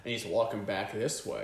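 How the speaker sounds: normal talking voice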